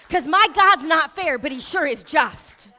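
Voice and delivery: loud, ringing preacher's proclamation; loud sermon climax; full-force preaching. Acted. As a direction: preach it out to the whole room. Preaching climax, crowd praise.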